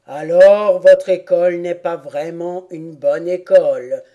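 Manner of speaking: loud, emphatic turtle verdict; tortue qui déclare. A narrator's reading. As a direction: declare it weightily. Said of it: "déclara la tortue". (tortue qui tranche, voix forte)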